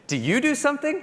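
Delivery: high, eager burst of interest; lightly imitated colleague perking up; excited. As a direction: pitch it up like he just caught on. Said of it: "He got really excited".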